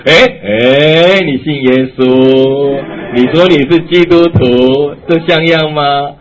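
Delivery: loud taunting jeer at the wife; mocking husband. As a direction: needle her to make her angry. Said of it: "故意逗他，激怒他". (嘲弄挑衅的丈夫腔)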